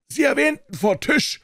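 shouting to be heard at a wild party; shouting over noise. A narrator's reading. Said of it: "brüllte ich ihm ins Ohr".

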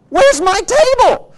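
high, loud, drawn-out outcry; upset wife. Acted. Wife comes home to find the table gone, acted outrage.

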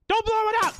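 high, loud frightened shriek; panicked shriek. Real, not acted. Player begging the monster, shrieking in panic.

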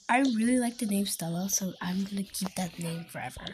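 soft secret whisper; a hushed confiding whisper; whispered aside. Real, not acted. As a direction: lean in and whisper to the viewers.

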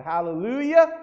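loud joyful cry; a woman bursting up from the baptism water with a joyful shout; shouted hallelujah. Retold or imitated. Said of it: "she shouted".

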